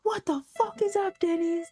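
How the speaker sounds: high shouted punk-band hype call; mock punk shout. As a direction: yell it like a singer firing up the crowd. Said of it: "they're just like".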